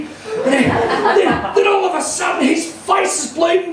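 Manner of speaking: usual speaking voice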